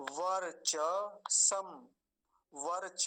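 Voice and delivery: वर्चसम drawn out slowly as var-cha-sam; full cha. Shown as a demonstration. Teacher sounding out the word for students, full cha. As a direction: give the cha in वर्चसम its whole vowel. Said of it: "चकार को पूरा करेंगे".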